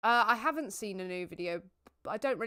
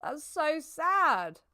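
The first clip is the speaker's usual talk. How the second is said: higher, louder, very slowly drawn out; mock lament; 7 semitones higher, 6 dB louder, much slower than usual. Streamer mock-mourning a game moment, drawn out high and loud. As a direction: ham up the sadness.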